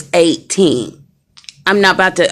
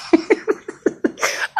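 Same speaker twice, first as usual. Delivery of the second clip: breathy laugh; 10 semitones higher, 7 dB quieter than usual. Real, not acted. Laughs at own joke.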